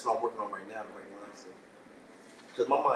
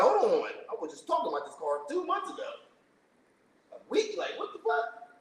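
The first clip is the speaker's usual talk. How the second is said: higher pitched astonished rant; stunned it came true; amazed realization. Retold or imitated. Realizing the car prayer came true, high amazed voice.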